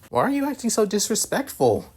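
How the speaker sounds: high-pitched, dismayed scolding; reproachful question. Acted. Asia scolds Issei in story.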